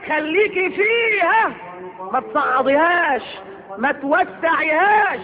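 slow, drawn-out advice; slow emphasis. Real, not acted. Preacher urging wives not to widen arguments, slow and emphatic. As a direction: stretch each word to stress it. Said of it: "advice stressed slowly".

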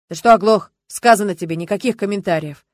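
rude brush-off. Acted. Official snapping at the reporter.

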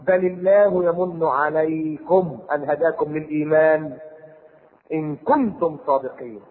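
slow chanted verse; Quranic recitation. Acted. Preacher reciting to congregation, slow chant. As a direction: intone the verse slowly.

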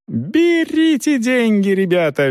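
high, drawn-out squeal. A narrator's reading. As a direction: squeal it out slowly to rouse the crowd. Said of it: "визжала рыжая".

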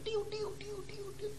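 high thin whistling spirit sound; spirit entering whistle. Shown as a demonstration. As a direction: whistle the ghost flying in. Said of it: "itu rohnya masuk".

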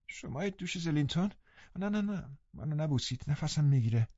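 put-on high, soft, breathless invalid boy; sickly boy. A narrator's reading. Linton to Catherine, high and frail. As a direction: shrink away, weak and fretful.

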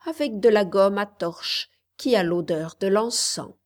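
plain reading voice

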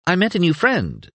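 high, bright and cheerful. A narrator's reading. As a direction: announce it brightly. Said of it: "said Nicus brightly".

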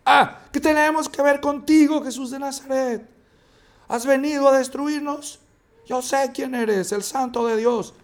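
high, strained demonic cry. A narrator's reading. The demon shouting at Jesus in the synagogue, high and strained. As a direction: rise high and cry out like the possessed man. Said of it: "Y gritó a gran voz".

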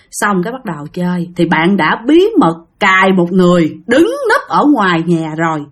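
ordinary talk

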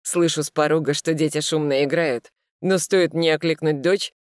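plain reading voice

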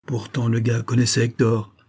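ordinary delivery

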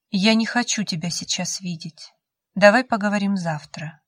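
plain reading voice

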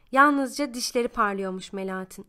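ordinary delivery

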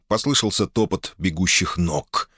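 plain reading voice

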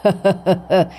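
a thin eerie cackle from the ghost; old ghost cackling at a frightened man; ghostly cackle. Acted. Ghost cackles at Tư, eerie laugh.

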